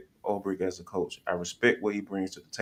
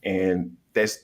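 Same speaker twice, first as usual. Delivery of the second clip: ordinary talk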